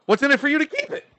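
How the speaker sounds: high, fast comeback; sharp elf retort. Acted. Elf woman snapping back at the orc, high and fast. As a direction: fire the question back quickly.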